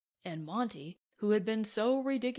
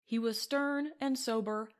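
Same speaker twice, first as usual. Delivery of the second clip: usual speaking voice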